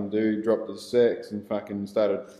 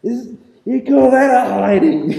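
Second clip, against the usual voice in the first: high, loud laughing taunt; taunting yell; 13 semitones higher, 11 dB louder than usual. Retold or imitated. Beaten on the ground he yells high loud taunts at attackers. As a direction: jeer at them from the ground, half laughing. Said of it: "I was just fucking yelling at them".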